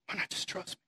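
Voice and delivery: breathy unvoiced whisper; a hushed whispered dare; whispered plea. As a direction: whisper it like an invitation. God voiced coaxing softly.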